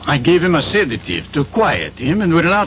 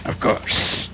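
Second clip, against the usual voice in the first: whispered reply; 6 dB quieter than usual. Acted. Doctor confirms stretcher quietly.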